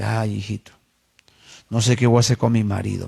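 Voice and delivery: low, subdued retold sorrow; retold tearful lament. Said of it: "Y llorando me dice".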